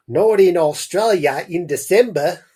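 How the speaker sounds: hammed-up attempt at an Australian mum; bad Australian accent. Reader tries Mum's line after realising it's Australia, bad Australian accent. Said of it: "I don't know if I could pull off an Australian accent".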